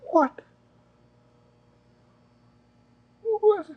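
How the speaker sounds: rising shocked disbelief; can't believe the news. Real, not acted. Stunned by the surprise.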